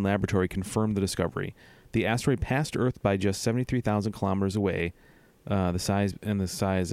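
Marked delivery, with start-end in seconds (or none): none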